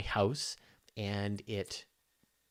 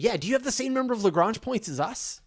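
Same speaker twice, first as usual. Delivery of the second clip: much higher and louder, gleeful quiz question; mock excited question; 11 semitones higher, 8 dB louder than usual. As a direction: pepper the alien with giddy questions. Joking questions to an alien.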